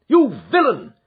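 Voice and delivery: loud, high angry call; confronting the burly man; furious accusation. A narrator's reading. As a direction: hurl it at him. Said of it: "said he".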